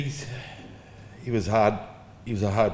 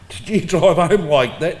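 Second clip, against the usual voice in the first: higher, louder and slow, like a shocked mum; mock outraged mum; 7 semitones higher, 9 dB louder, slower than usual. Mum confronting dad for driving home with L plates, loud mock outrage. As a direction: pitch it up and slow, aghast.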